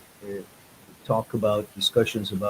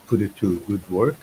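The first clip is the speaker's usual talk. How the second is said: ordinary talk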